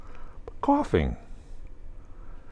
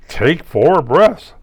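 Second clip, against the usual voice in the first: slow, firm, louder instruction; doctor's order; 6 semitones higher, 7 dB louder, slower than usual. Retold or imitated. Quoting his doctor's advice, slow and firm. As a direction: spell it out like a patient doctor. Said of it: "My doctors say".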